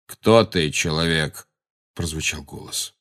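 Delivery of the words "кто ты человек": lightly voiced stern centaur; gruff centaur. A narrator's reading. Magorian confronts Umbridge in the clearing, gruff centaur. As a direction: challenge the stranger gruffly. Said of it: "грубо повторил Магориан".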